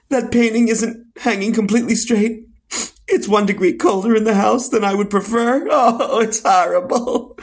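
high-pitched fussy whine; whiny kvetching. Acted. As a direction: moan about trifles like disasters. Kvetching over trivial things.

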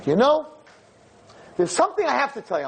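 put-on high, quick earnest girl voice; a young woman leaning in with news; imitating girl. Girl about to confront rabbi.

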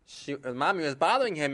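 ordinary delivery

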